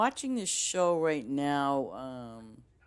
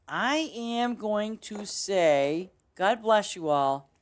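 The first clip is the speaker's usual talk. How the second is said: slow, higher, louder proclamation; drawn-out blessing; 6 semitones higher, 6 dB louder, much slower than usual. Real, not acted. Streamer signing off to viewers, slow grand blessing. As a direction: stretch each word out grandly.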